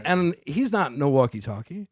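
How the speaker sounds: ordinary talk, nothing marked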